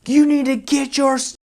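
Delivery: high put-on angry client yelling; mock screaming boss. Furious client yelling down the phone, high mock voice. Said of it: "I mean, just screaming".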